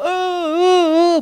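very high, sustained dog wail; long mock canine whine; imitated dog howl. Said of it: "en el frente de una casa ahí llorando".

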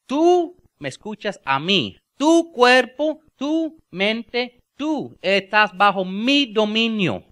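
high, loud, slow commanding voice; stern command to oneself; commanding self. Acted. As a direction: order your own body to obey, slowly. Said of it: "y le digo".

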